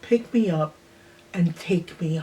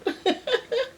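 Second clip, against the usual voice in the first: high laugh; 3 dB quieter than usual. Real, not acted. Laughing about liking hot dogs now.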